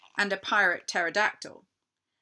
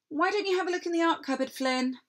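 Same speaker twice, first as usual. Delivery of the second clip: bright, quick teacher's call; a cheerful teacher calling across the class; 11 semitones higher, faster than usual. A narrator's reading. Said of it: "said Miss Pye's teacher".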